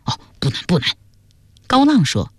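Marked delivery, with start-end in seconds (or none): 0.1-1.0 quick, soft backpedalling; softly eager to comply; hasty reassurance. A narrator's reading. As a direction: hurry to agree with a superior. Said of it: "秋冬忙说".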